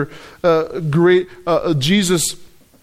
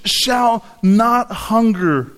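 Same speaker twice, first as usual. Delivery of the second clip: slow, measured stress on each word of "Shall not hunger"; emphatic; 3 semitones higher, much slower than usual. Shown as a demonstration. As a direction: draw out "Shall", then land firmly on "not hunger". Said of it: "the most emphatic way of saying".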